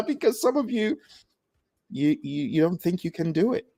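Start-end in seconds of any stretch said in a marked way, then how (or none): none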